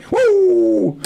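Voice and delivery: high, long "woo" whoop; drawn-out high-pitched cheer; excited whoop. Real, not acted. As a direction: whoop it up in celebration. Celebrating the idea of watching himself.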